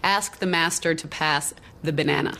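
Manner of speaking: American accent, hard R on "master" and a flat "a" in "ask" like "æsk". Shown as a demonstration. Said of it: "to be said by an American".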